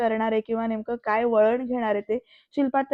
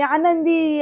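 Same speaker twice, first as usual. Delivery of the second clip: higher, louder shout of the name; 5 semitones higher, 5 dB louder, much slower than usual. A narrator's reading. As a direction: yell the name in rage. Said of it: "जोरात ओरडून". (जोरात ओरडून हाक)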